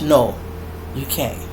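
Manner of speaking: ordinary talk, nothing marked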